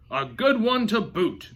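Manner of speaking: high, loud, slow chorus of pirates; crew agreeing. A narrator's reading. The crew agrees from the boat, loud slow echo. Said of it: "the others agreed".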